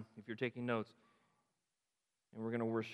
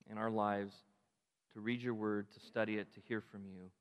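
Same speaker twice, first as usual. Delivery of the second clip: closing prayer; 4 semitones lower than usual. Acted. Pastor leads closing prayer.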